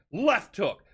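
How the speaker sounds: ordinary delivery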